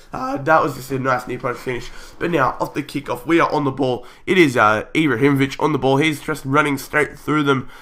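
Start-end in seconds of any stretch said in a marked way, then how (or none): none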